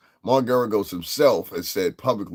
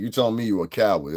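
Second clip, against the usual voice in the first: ordinary delivery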